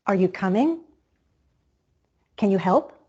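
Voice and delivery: yes-no question rising on "coming" and "help"; rising intonation. Shown as a demonstration. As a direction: lift the pitch at the end, on "coming" and "help". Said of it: "Rising intonation is used with many questions".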